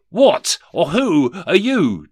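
slow, high, loud demand. Acted. Scrooge confronts the unknown ghost, demanding loudly. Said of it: "demanded Rishi Scrooge".

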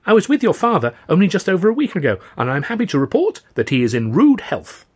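put-on high, loud, eager young man; all enthusiasm. A narrator's reading. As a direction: report good news with gushing enthusiasm. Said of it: "The newcomer was all enthusiasm".

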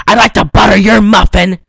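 hammed-up loud come-on; mock lecherous exclamation; loud pick-up line. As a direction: belt out a lewd come-on as a character. Acts a leering pick-up line.

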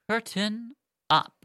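high, slow curtain call; stage announcement. Pretend. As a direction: announce the show with drawn-out ceremony. Announces start of the scene.

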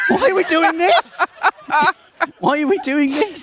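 laughing mortified wail; embarrassed protest. Real, not acted. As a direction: squeal in mock despair. Embarrassed protest after impression.

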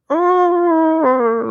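high, held mock scream; small distant scream. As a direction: imitate a scream from behind a closed door. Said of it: "you hear those particular screams".

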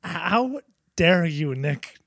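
mock outrage, rising as it goes; outraged. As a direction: act deeply affronted, drawing out each word. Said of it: "[name], you look outraged".

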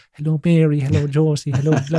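high, sing-out crowd greetings. Retold or imitated. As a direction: greet audience members one by one in a high mock stage voice. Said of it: "he does the accent".

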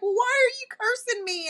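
high, wounded-sounding question; re-enacted plaintive objection; retold protest. Wife protesting in retold quarrel.

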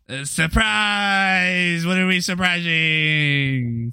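high, drawn-out belated shout; yelling surprise. Pretend. Birthday guest clueless about his own party, yelling surprise. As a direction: join the yell late, stretched out slowly. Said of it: "you don't have to yell surprise".

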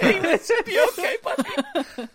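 high, loud, laughing call-out; mock concerned call. Calling to Rincewind after dozens of rocks, mock concern, loud and high. Calls to character hit by rocks.

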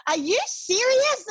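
mock annoyed mum. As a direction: imitate an irritated mother. Mother scolding asthmatic child.

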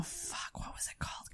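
whispered to herself. Real, not acted. Host trying to recall a place name, whispering. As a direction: hush it while searching memory. Forgetting a name mid-story.

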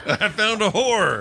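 giggling as he repeats it; mock proud laughing declaration. Repeating the funny line.

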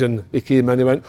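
ordinary talk

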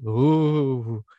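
mock reverent gasp of wonder; mock awed ooh. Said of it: "everyone goes".

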